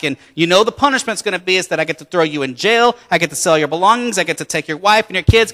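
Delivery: loud, insistent creditor laying down the law; stern refusal. Acted. Master refuses debtor, lays down the rule.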